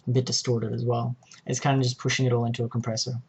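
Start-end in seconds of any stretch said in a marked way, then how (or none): none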